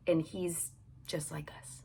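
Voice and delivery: a hushed, voiceless whisper; whispered aside. Real, not acted. Telling viewers Peter is just like us, whispered. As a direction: let it drop to a secret whisper.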